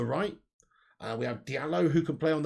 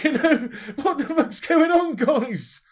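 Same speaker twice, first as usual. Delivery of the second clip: laughing; 11 semitones higher, 10 dB louder, slower than usual. Real, not acted. Laughing outrage at trampled dad.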